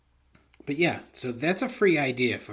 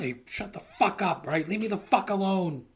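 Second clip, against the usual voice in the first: mock irritated snap, pitched higher; grumpy old Cooper; 4 semitones higher than usual. Old DB Cooper snapping at a nosy neighbour, mock irritated. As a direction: brush him off like a bitter old crank.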